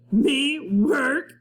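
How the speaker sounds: high, loud scoffing pirate; a captain mocking the idea of working.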